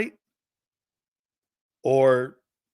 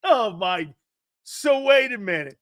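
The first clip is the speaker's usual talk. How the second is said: ordinary talk, nothing marked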